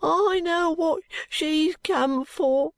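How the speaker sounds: put-on high, slow wail of a sick boy; boy crying out. A narrator's reading. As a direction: cry it out high and drawn-out as a frightened boy. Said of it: "cried the boy".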